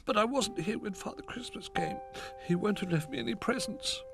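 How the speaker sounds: sad cub. A narrator's reading. Little Bear sad.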